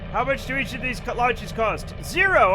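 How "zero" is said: gleeful shout. Real, not acted. Answering his own question.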